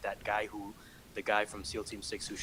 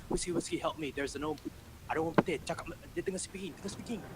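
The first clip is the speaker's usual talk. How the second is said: higher, flustered plea; mock panic; 8 semitones higher than usual. Call centre staff pleading with the supervisor, mock panic. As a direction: imitate a flustered colleague begging for rescue. Panicked colleagues begging for help.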